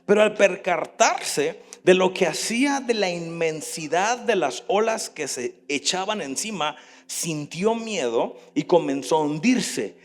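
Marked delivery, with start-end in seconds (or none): none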